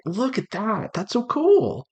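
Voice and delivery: normal talking voice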